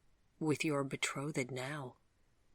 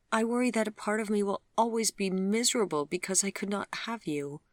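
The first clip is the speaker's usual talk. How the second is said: put-on higher, quicker young woman's voice; Vivian, troubled; 7 semitones higher, 3 dB louder than usual. Vivian admitting her misery to Gregory, higher and rushed. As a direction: let the worry tumble out. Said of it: "she finally said".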